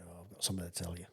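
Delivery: soft and hurried, like not waking the house; hushed quick quote. Retold or imitated. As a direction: murmur it quickly at night. Wife whispering in the night.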